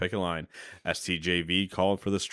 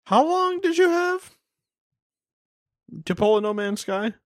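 incredulous question; 13 semitones higher, 5 dB louder than usual. Real, not acted. Challenging No Man's Sky comparison.

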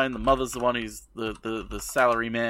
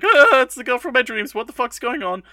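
mock shocked; 11 semitones higher, 6 dB louder than usual. Madoka sees dream girl.

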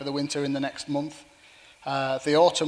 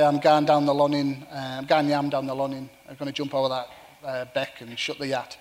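Cumbrian accent; 4 dB louder than usual. Shown as a demonstration. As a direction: speak it in thick Cumbrian. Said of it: "I can use my real Cumbrian voice".

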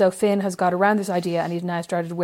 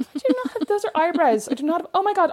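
indignant high protest; 8 semitones higher than usual. Real, not acted. Indignant denial.

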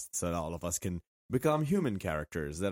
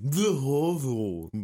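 mock posh; 5 semitones higher, 3 dB louder, much slower than usual. Mocking pretentious stadium name.

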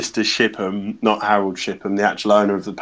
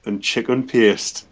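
put-on Geordie accent. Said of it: "do them again in the Geordie accent".

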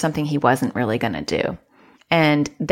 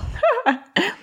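a burst of high laughter; quick high giggle. Real, not acted. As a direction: laugh it off, amused. Laughs at own bad singing.

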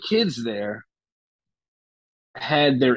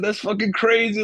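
high, hyped-up yelp of disbelief; an excited teen freaking out; excited kid; 8 semitones higher than usual. Retold or imitated. A kid in the van freaks out, excited and high. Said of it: "like, going crazy in the van".

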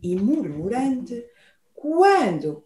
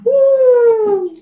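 spooky wail; 9 semitones higher, 7 dB louder than usual. A narrator's reading. The horrible sound.

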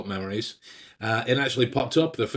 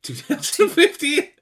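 high, slow stunned question; can't believe the year; shocked disbelief; 5 dB louder than usual. Real, not acted. Disbelief at the date.

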